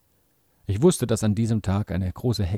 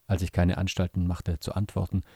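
ordinary delivery

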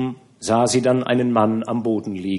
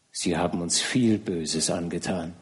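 softer, lower broken repeat; tragic heroine sorrow; 6 semitones lower, 7 dB quieter than usual. A narrator's reading. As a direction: let the repeat sink lower. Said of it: "sagte zweimal".

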